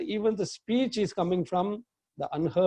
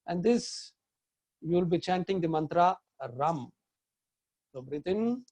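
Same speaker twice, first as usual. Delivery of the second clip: guided meditation. Real, not acted. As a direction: lead them gently through the breath. Leads a guided chakra meditation.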